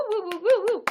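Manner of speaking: high, loud playful cheer; celebratory hooting; cheering woo. Real, not acted. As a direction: whoop it up for the viewer. Cheering a viewer's support.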